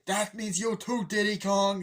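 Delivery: high, slow, loud call-out; single someone out across an arena; mock stern shout, stretched out; imitated scolding. Scolding called out to [name].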